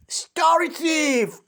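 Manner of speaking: high, slow accusing cry; cried out. A narrator's reading. Olive cries out as her book is snatched. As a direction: cry out at the thief. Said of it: "cried Olive".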